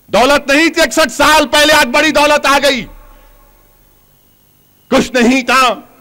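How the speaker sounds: high-pitched declaration to the crowd; impassioned public reproach; emphatic oratory. Real, not acted. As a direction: proclaim it from the podium.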